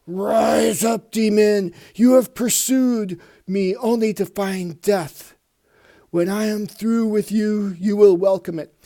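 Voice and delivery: warrior's battle shout. Acted. Beta Ray Bill shouts his challenge at the demon, booming and fierce. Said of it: "He goes".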